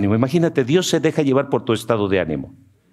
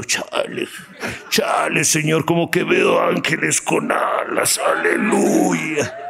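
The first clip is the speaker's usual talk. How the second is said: higher, slow, stoned exclamation; high drunk voice; 5 semitones higher, slower than usual. Retold or imitated. Stoned man sees angels, higher and drawn out. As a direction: ham up a stoned praise. Drugged person praising God.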